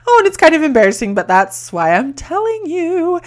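very high voice, squeezed by laughter; squeaky, laughing admission; real embarrassed giggling. Real, not acted. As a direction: confess it while cracking up. Laughing at her embarrassing story.

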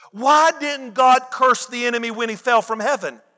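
normal talking voice

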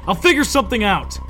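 loud, high urgent call; shouted. A narrator's reading. Narrator calling to the sister mid-fight, shouting. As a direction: shout reassurance in a hurry. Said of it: "I shouted".

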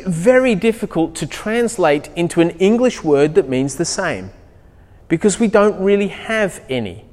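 normal talking voice

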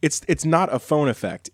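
ordinary delivery

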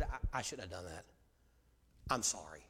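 very low, hushed regret; humble apology. Acted. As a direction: apologize softly to your child. Humble apology to son, re-enacted.

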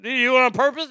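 high, loud questioning mother; alarmed mom. Retold or imitated. Mother questioning her hurt son, loud and high. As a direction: grill him like a worried mom.

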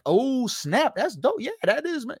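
high-pitched imitation of being impressed; mock hyped reaction. Imitating someone hit with a one-liner, mock excitement. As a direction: gush over a clever one-liner, hammed up. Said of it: "a one-liner that's like".

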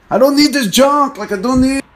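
high, loud imitated yell; mock yell. Guy yelling back in the heated argument, high mock yell. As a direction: mimic him yelling back at you. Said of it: "when he's yelling".

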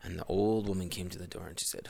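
usual speaking voice

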